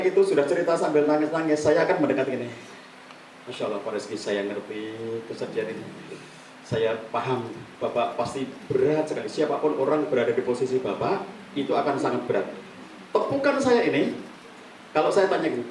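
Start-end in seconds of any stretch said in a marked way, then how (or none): 3.5-12.5 soft, gentle comfort; empathetic counselor. Shown as a demonstration. Counselor comforting a grieving client, softly. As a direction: lean in and soothe him quietly. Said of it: "ini contoh aja ya".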